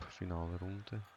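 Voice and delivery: usual speaking voice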